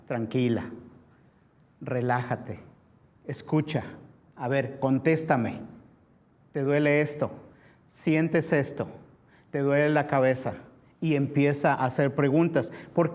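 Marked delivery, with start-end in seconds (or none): 0.1-10.5 calming paramedic. Acted. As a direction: settle a crying accident victim, gently. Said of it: "pero ¿qué dice el paramédico?".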